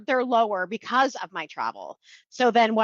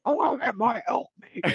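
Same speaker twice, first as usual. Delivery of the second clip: dopey caveman voice, laughing; mock a caveman craving meat; 4 semitones higher, faster than usual. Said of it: "fucking caveman Matt".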